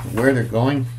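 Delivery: ordinary delivery, nothing marked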